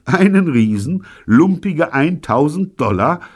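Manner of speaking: loud, high ironic incredulity; ironic scoff. A narrator's reading. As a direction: throw it back as a mocking question. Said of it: "Ich lache ironisch".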